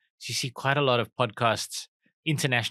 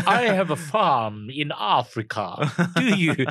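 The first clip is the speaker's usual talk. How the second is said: slow, louder, hammed-up film South African accent; Val Kilmer's South African accent; 3 semitones higher, 3 dB louder, slower than usual. Repeating the mock of Val Kilmer's accent, slow and louder. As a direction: drag it out like a bad Hollywood actor. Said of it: "Who taught you this accent?".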